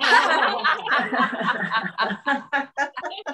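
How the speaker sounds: rising burst of laughing; real laughter. Real, not acted. Teacher laughs with the group, real laughter. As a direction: laugh freely. Laughter before good job.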